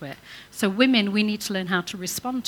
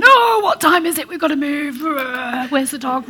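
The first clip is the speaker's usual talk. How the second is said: much higher and louder, hurried panic; frantic morning rush; 6 semitones higher, 10 dB louder than usual. Pretend. Wife mimicking a rushed morning, frantic and loud. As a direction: shout it like you've overslept. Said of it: "Rather than".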